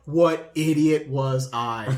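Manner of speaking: slow, drawn-out self-mocking question; slow mock disbelief at his own past mistakes; mock self-scolding. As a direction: groan at your younger self, slowly. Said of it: "just be like".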